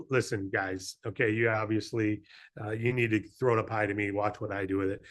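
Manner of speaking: low, soft, confident giraffe; bossy and low, like a tall show-off. Acted. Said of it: "now the giraffe says".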